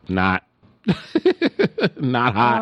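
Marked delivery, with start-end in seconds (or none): 0.9-1.9 squealing laugh; sudden high laugh; high burst of laughter. Real, not acted. Laughs at [name] answer.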